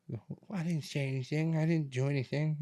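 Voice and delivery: high, put-on innocent denial; mock defensive protest. Son mimics mom denying she said anything, mock defensive.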